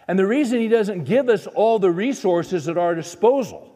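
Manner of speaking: preaching at full force. Acted. Preacher urging the congregation to trust God, full force. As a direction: drive the message home to the congregation.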